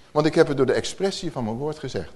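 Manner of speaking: usual speaking voice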